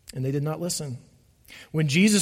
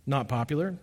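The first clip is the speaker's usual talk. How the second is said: ordinary talk, nothing marked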